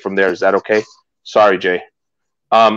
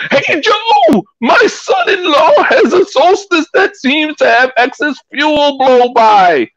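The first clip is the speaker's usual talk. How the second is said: high, slow, drawn-out hollered caricature; Dale Lucid; 11 semitones higher, 3 dB louder, slower than usual. Acted. Host reading viewer's question to Joe, high hollered impression. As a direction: holler it slowly in an exaggerated high put-on voice. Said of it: "I'm gonna try to do Dale Lucid here".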